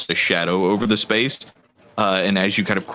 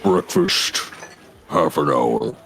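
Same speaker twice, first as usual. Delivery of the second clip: lightly voiced firm orc; curt, gruff orc announcement; gravelly voice; 5 semitones lower than usual. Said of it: "that same kind of, like, firm look, he says".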